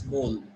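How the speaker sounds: low "bowl" with an O sound; correct bowl. Shown as a demonstration. Teacher summing up the O sound, bowl like bole. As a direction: say "bowl" to rhyme with goal. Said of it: "The O sound you'll get".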